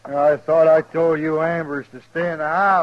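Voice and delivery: loud, angry yell at the family; angry shout. Acted. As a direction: bark it at them like an order. Foreman yells at the Ambers.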